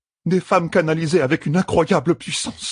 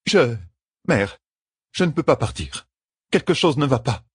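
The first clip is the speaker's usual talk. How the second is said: higher, halting, worried admission; alarmed; 7 semitones higher than usual. A narrator's reading. Sister telling her leader she is trapped, alarmed. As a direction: falter with pauses, worried.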